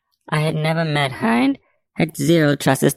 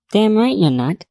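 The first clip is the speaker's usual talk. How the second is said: chiming in; 8 semitones higher than usual. A narrator's reading. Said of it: "I chimed in".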